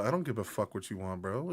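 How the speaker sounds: low, quiet and fast; low tough dog voice. Retold or imitated. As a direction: mutter it like an unbothered tough dog. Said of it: "he'd probably be very like".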